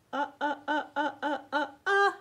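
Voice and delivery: repeated short "uh" while tracing; rhythmic "uh" as she traces; short u sounds. Shown as a demonstration. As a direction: tap out "uh, uh, uh" in rhythm. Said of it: "tracing with sound".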